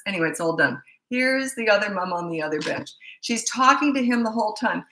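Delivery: ordinary talk, nothing marked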